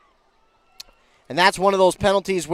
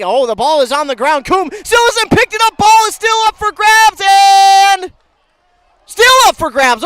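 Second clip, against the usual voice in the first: rising, loud fumble call; excited call; 13 semitones higher, 10 dB louder than usual. Real, not acted. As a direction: shout it as the ball bounces loose. Fumble on live play-by-play.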